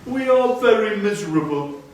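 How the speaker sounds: a small grieving bird cries out; Darzee the tailorbird. A narrator's reading. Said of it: "said Darzee".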